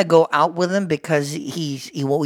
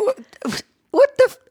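a stunned what the f-; shocked outburst. Real, not acted. Co-host reacts to his crude question, shocked.

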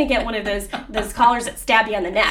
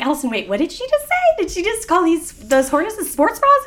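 higher, fast incredulous whisper-to-friend; mock disbelief; 8 semitones higher than usual. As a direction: act stunned at the comment. Retold disbelief at trainer's remark.

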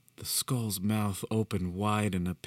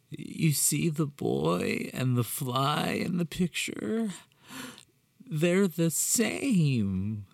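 unhinged villain; 6 semitones higher, slower than usual. Acted.

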